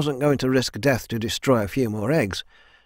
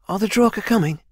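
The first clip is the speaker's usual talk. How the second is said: higher-pitched character voice; anxious question; 9 semitones higher, 3 dB louder, faster than usual. A narrator's reading. Swordswoman questioning the leader about enemies, anxious. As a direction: ask it with rising worry.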